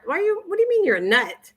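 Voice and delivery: high-pitched, incredulous questioning. Real, not acted. Reacts to a viewer's comment.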